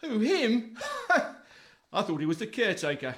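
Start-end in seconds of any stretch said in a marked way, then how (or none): 0.0-0.8 high, loud incredulous query; scoffing disbelief. Acted. Scoffs at introduced financial advisor. 0.8-1.6 high, loud burst of mocking laughter; short scornful guffaw; derisive laugh. Laughs at the supposed advisor.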